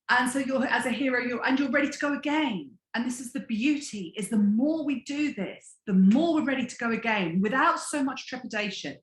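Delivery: usual speaking voice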